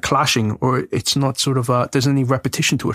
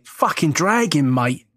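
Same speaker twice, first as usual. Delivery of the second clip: slow, drawn-out mock London swearing; retold awe in a hammed-up London accent; much slower than usual. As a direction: drag out the words in a put-on London accent. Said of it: "I was, like, going".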